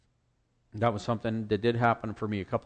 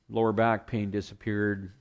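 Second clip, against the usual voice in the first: ordinary delivery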